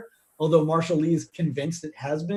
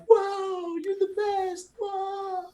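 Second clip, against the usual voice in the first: high, awestruck crowd voice; awed cheering; much slower than usual. Acted. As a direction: gasp it out like a starstruck fan.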